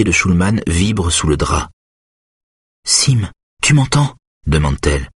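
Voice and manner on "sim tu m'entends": higher woman's voice calling to a patient; Simone, anxious. Acted. Simone asks Schulman if he hears, anxious higher voice. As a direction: call gently but urgently to someone unconscious.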